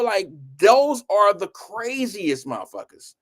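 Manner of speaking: ordinary delivery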